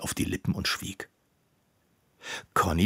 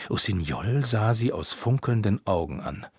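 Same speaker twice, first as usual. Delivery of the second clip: usual speaking voice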